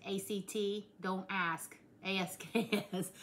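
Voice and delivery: A C T spelled letter by letter; spelling it out. Shown as a demonstration. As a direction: spell out A C T and A S K S slowly. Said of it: "but I said act".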